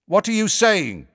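loud, high questioning shout; very loud demand. A narrator's reading. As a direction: call out loudly to hidden speakers. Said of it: "he said very loudly".

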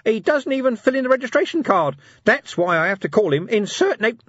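high, loud tattling imp; indignant imp. Acted. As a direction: complain like a wronged gadget. Imp's voice continues.

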